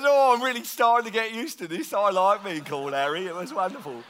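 put-on British accent, starting high; strong British accent. Local happy with his new nickname, strong British accent. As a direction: chat away in a mock British accent. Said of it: "he's got this really strong British accent".

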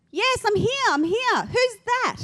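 high, loud, eager reply; acted yelling back. Dangling man answers unseen rescuer, high urgent yell. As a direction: call back urgently, pitched well up. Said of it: "He kept on yelling out".

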